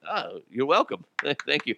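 ordinary delivery

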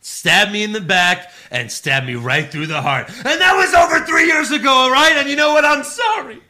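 high, loud shouted promo; wrestling promo. Retold or imitated. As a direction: yell it like an emotional wrestler.

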